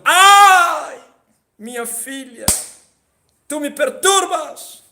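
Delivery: high, loud cry of despair; anguished cry. Acted. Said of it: "E ele gritou". (grito angustiado do pai)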